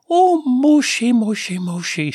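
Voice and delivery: mother cooing over her pet snake; sing-song motherly. Acted. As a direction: coo to the snake like a doting mother. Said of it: "she said in a sing-song motherly tone".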